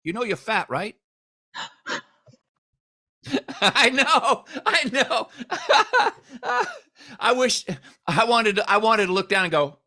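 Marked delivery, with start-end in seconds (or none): 0.0-0.9 put-on teasing doctor, higher, Indian accent; deadpan jab in the accent; higher pitched accented tease. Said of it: "He said". 3.2-6.7 high laughing "I know"; giggling admission. Real, not acted. Laughing at the doctor's joke.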